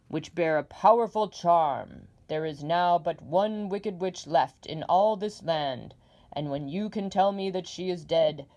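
loud, booming giant head; demanding Oz. Acted. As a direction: boom out the demand. Oz states his condition.

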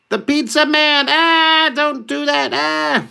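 high, loud frightened squeal; panicked pizza man. Retold or imitated. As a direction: shriek as the arrow comes. Pizza guy panics at arrow.

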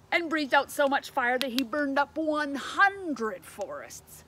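loud, slow, punchy storytelling; big emphatic telling; dramatic narration. A narrator's reading. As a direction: pour it out with growing force. Narrator dramatizes second fire breath.

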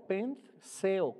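"pentheo" slowly, with the stress on the E; careful Greek pronunciation. Shown as a demonstration. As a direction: teach "pentheo" slowly, marking the accented E. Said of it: "con acento en la E".

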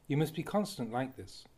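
ordinary talk, nothing marked

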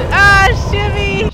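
loud, slow gleeful cry; excited shout. Real, not acted. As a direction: belt it out with glee. Excited call in game.

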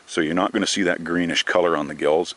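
ordinary talk

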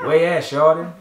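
loud call from a window; hearty shout from a doorway. Acted. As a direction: call out across the street, friendly. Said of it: "called out the window".

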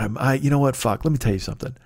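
usual speaking voice

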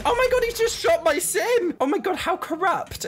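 very high, loud shriek; outraged shock. Real, not acted. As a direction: shriek it in disbelief at the injustice. Cop shoots her sim.

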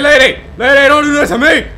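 loud startled yell at the ghost; panicked shout. Real, not acted. Streamer jumpscared by the ghost lady, panicked shout. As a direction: scream out in real fright.

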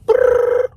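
high, loud trilled phone ring. Retold or imitated. Imitates a phone ringing.

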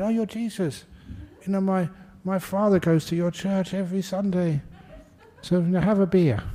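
high-pitched, delighted recognition with some laughter; excited greeting. Acted. Joke's pub-goer offers Jesus a beer, excited.

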